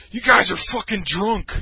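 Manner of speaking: loud, high, slowed mock-drunk yell; imitated drunken outburst; drunk indignant accusation. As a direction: accuse them like an outraged drunk. Acted drunk friend accusing others.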